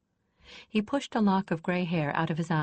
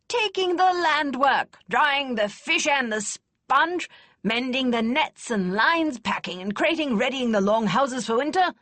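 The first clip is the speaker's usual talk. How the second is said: put-on louder, gruff old lady scoffing kindly; snorting, not unkind; 8 semitones higher, 5 dB louder than usual. A narrator's reading. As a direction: snort at the lad but keep it kind. Kester listing the work.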